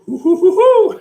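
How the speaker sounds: hammed-up cartoon tiger hooting; Tigger laugh. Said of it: "I can't do it".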